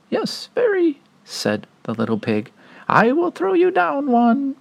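very high pig voice; little pig voice. Acted. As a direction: chirp it up high.

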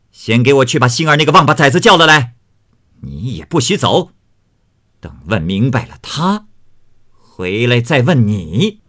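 loud angry cursing, rising in pitch; furious scolding. A narrator's reading. Fengjie curses the servants for covering up, in fury. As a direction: tear into the servants. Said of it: "骂道".

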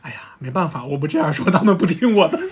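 ordinary talk, nothing marked

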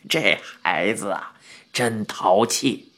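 put-on old man grinning, pinning the blame on the boy; sly grinning old man. A narrator's reading. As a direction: tease the boy with a grin. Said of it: "老头冲我一乐说道".

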